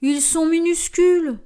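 high, defensive giant voice; dim slow booming giant. Acted. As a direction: protest like a big clumsy giant. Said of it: "se défend le géant". (géant qui se défend)

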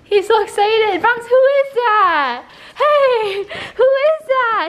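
high-pitched squeal of excitement for the dog; excited dog talk. Real, not acted. Owner riling up the dog over a visitor, excited. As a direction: hype up the dog, high and drawn out.

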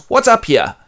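high, loud call; a queen walking into a row; Jocasta's voice. Acted. Jocasta stepping into the quarrel, high and loud. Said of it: "Jocasta comes out and goes".

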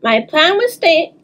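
ordinary delivery

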